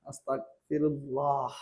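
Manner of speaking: very soft, slow, drawn-out low murmur; hushed aside. Real, not acted. Teacher to himself after reading out the example sentence, hushed aside.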